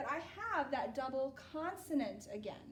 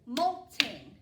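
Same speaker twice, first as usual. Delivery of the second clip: molting clapped out in two beats; teacher clapping a word; clapped syllables; 10 dB louder than usual. Shown as a demonstration. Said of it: "I want you guys clap it with me".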